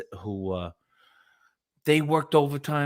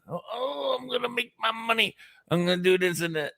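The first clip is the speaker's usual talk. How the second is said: high, softer mock Australian voice; Australian impression; 7 semitones higher, 4 dB quieter than usual. Imitating the Australian guest's money plans, mocking Australian accent. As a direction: mimic the Aussie dreamer. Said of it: "he was from Australia".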